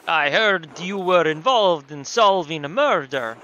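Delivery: put-on loud, slow, accented innkeeper; big drawn-out host voice; Nico from GTA IV. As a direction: present the house in a hammed-up foreign accent. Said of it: "do Nico from G T A four".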